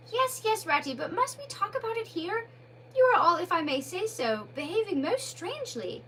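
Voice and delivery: Mole insists on who he is, put out; irritable. Acted.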